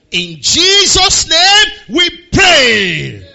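slow, loud, high prayer close; drawn-out shouted amen. Real, not acted. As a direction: stretch out the closing line as a cry. Closing prayer cry.

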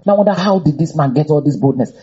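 ordinary talk

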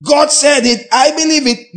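mock believer. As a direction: parody a glib believer claiming it all. Mocking a believer's bold claim.

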